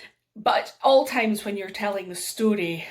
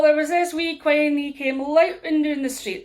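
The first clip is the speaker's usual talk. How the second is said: broad Aberdeen Doric, higher pitched; raised storyteller's Doric from Aberdeen; Doric dialect; 6 semitones higher, 4 dB louder than usual. Shown as a demonstration. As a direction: tell it in lively Doric. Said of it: "speak a wee bit of Doric".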